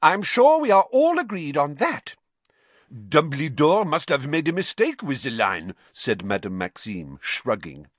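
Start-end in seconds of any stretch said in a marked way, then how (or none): 0.0-2.2 high, loud, insistent; sharp. A narrator's reading. McGonagall insisting all are agreed, sharp. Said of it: "said Professor McGonagall sharply". 2.9-5.7 higher-pitched suggestion; dismissive French headmistress. Acted. Madame Maxime blaming Dumbledore, shrugging.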